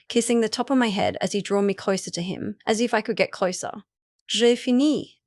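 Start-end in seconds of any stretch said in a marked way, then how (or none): 4.3-5.2 put-on light French accent, a little higher and brighter in pitch. Edwin announcing the omelette is done, light French accent. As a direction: savour the French words J'ai fini like a proud chef. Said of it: "Edwin said with a French accent".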